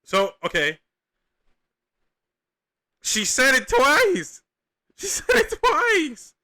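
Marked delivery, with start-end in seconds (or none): 3.0-4.4 slow, much higher and louder, stretched out in disbelief; can't believe she said it twice; stunned disbelief. Real, not acted. Reacting to clip, incredulous. 5.0-6.3 even higher squeal of disbelief with a laugh breaking in. Real, not acted.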